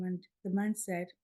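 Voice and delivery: ordinary delivery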